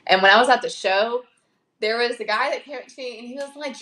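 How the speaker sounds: usual speaking voice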